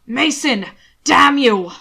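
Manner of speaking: loud furious shout at the fleeing man; enraged bellow. Acted. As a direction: roar his name in fury.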